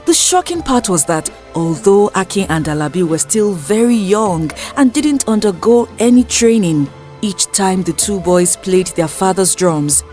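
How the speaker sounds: ordinary delivery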